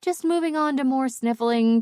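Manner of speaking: usual speaking voice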